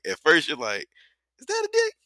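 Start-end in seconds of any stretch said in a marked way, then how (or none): 1.4-1.9 high-pitched puzzled question; mock disbelief. Viewer retelling first glimpse of the nude scene, mock disbelief. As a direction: squeak it out as a baffled double-take.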